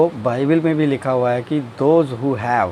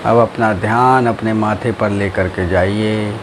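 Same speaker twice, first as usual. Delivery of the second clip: low, slow drawn-out meditation voice; guided meditation; 5 semitones lower, slower than usual. Acted. Teacher guiding friends through breathing and relaxing, guided meditation. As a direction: stretch key words slowly, sinking lower at each phrase end.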